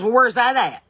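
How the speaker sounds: mock pushy customer; impatient demand. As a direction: snap the question like an annoyed shopper. Woman demanding.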